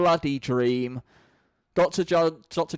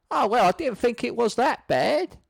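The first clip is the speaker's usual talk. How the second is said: hurt Baldric; 7 semitones higher than usual. Acted.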